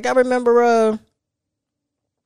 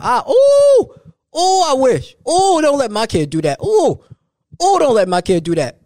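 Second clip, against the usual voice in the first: scandalized parent; 8 semitones higher, 4 dB louder than usual. Pretend. Imitating a parent watching kid act up.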